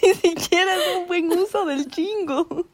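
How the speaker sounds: high squeaky laugh-crying through the words; can barely get it out for laughing; laughing so hard it cracks. Real, not acted. Mocking her friend's slang, laughing to tears.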